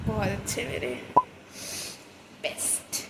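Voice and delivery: choked softness. Real, not acted. Emotional praise of papa.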